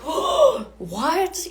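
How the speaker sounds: high, loud gasp of disbelief; sudden high, loud astonishment; shocked surprise. Retold or imitated. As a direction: squeal it out in shock. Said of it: "my whole soul was like".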